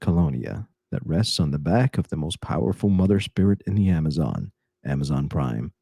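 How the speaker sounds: put-on suave old film star; Hollywood voice. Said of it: "do it in your Hollywood voice".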